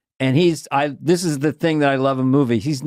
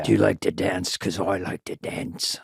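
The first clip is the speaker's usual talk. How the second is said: action-star impression; 7 semitones lower, 5 dB quieter than usual.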